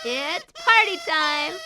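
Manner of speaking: loud, high, slow festive shout; party shout. Acted. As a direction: kick off the party. Announcing the party.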